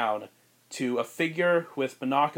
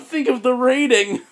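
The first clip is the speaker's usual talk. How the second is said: high, loud, drawn-out yell; slow hammed-up cry of alarm; mock panicked plea; 10 semitones higher, 9 dB louder than usual. Mock protest.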